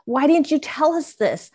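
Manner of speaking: high, pleading voice, retold; upset young son. Youngest son to mom after her story, retold upset. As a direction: voice a distressed child, pitched well up. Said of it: "he started crying".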